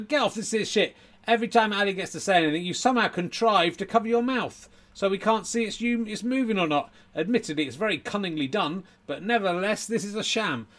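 high-pitched indignant heckling; heckler. Acted. Audience heckler accuses the ventriloquist of cheating, high indignation. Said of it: "was a heckler in the audience".

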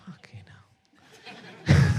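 hushed under-the-breath swear; whispered curse. Real, not acted. Host reacting to a fan's request, whispered swear. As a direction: drop to a bare whisper for the curse. Muttered curse at a donor's joke.

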